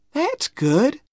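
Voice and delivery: put-on high-pitched, pleased girl; approving little girl. A narrator's reading. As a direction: approve the drawing in a high girlish voice. Becky admires Tom's drawing.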